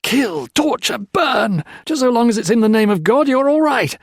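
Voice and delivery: loud, high furious tirade; raving at the priest about bloodshed. A narrator's reading. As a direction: hurl every grievance in rage. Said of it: "Peter Marlowe raved".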